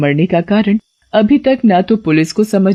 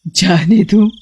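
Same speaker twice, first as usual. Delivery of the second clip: sneering witch echo; mocking witch; slower than usual. Witch mocking the driver's plea, sneering. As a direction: toy with your prey.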